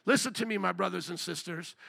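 ordinary talk

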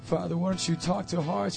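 ordinary talk

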